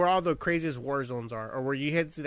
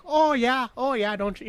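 put-on high, lilting northern accent; Minnesota-Canadian accent; 8 semitones higher than usual. Showing how northerners all sound, high Minnesota accent. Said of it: "sound like the Minnesota accent".

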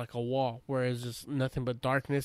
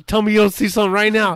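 mock urgent plea; 7 semitones higher, 13 dB louder, faster than usual. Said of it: "radioing me, like".

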